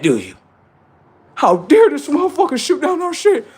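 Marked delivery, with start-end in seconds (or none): 1.3-3.5 high squeaky mock outrage, much higher; squeaky parody of an indignant Democrat voter; mock outraged Democrat. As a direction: mimic an offended party loyalist, way up high.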